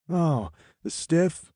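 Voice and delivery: high, slow and offhand; callous Eric voice. Acted. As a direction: drawl it carelessly.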